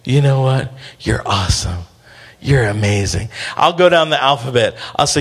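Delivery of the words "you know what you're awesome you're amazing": put-on low, soft talk into a baby's ear; tender murmured affirmation. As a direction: speak low and close, soothing a sleepy baby. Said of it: "I just start speaking in their ear".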